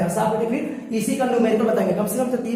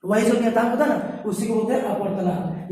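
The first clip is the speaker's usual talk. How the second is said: normal talking voice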